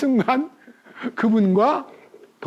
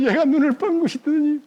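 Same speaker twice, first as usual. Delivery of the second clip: ordinary talk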